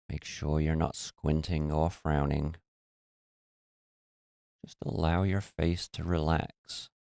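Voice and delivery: soft, even voice with long pauses; guided meditation. Real, not acted.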